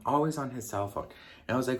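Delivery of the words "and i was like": ordinary talk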